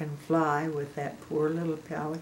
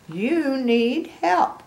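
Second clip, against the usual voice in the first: slow, higher, louder kind offer; kind pelican; 8 semitones higher, 7 dB louder, much slower than usual. A narrator's reading. Pelican to Piggy, slow kind offer. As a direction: offer help gently and slowly. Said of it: "and he said".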